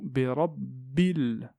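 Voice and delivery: "bi rabbil" drawn out, sliding from b to l; very slow glide. Shown as a demonstration. Teacher slows "bi rabbil" to show the skipped hamza. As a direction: stretch "bi rabbil" to show the link. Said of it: "je saute du ba".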